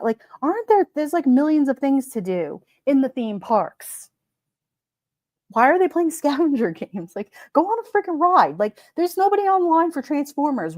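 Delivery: high, incredulous rant about the theme park; exasperated rant. Real, not acted. Venting to chat about kids in the theme park, high exasperated rant. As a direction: let the disbelief climb way up.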